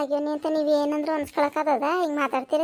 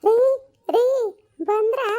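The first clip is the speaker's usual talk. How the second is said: higher-pitched wifely call; 7 semitones higher, 5 dB louder than usual. Acted. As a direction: call your husband over. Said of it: "ಅವ್ಳು ಕೂಗ್ತಿದಂಗ್ಲೆ". (ಗಂಡನನ್ನು ಕೂಗಿ ಕರೆಯುವುದು)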